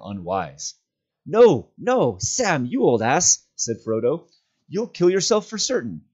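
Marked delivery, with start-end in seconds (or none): 1.2-3.5 high, loud, urgent no, no; alarmed cry. Acted. Frodo shouting at Sam on the brink, alarmed. 4.7-6.0 alarmed. Acted. Frodo stops Sam going over.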